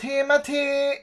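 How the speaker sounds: high-pitched imitation of mom saying his name; lightly mocking mom call. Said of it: "She's calling him Timothy".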